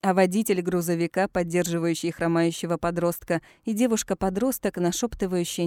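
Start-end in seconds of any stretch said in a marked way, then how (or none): none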